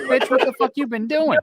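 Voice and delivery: high, incredulous mock accusation; mock accusing shock. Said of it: "I talked to her telepathically".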